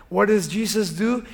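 usual speaking voice

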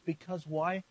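ordinary talk

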